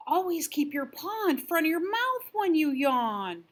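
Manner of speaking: lightly hooted advice; hooting owl. Acted. Owl lecturing the yawning rabbit, lightly hooted. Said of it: "hooted the owl".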